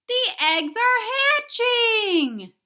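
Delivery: high, slow, far-off pig voice; sing-song Piggy. Acted. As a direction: drawl it out teasingly.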